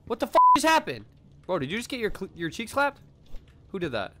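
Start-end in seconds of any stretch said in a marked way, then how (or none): 0.1-1.1 fast, loud, high disbelief; shocked outburst. Real, not acted. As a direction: blurt it out in shock. Sudden event in game.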